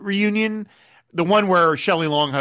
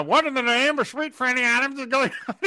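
high put-on Colonel Potter bluster; hammed-up old colonel exclaiming; Harry Morgan impression; 9 semitones higher than usual. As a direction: Klinger faking Colonel Potter's voice. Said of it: "the best Harry Morgan impression".

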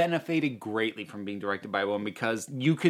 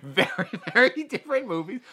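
laughing, pushing hard on very, higher pitch; laughing emphasis; 8 semitones higher than usual. Real, not acted. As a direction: laugh while leaning on the first very.

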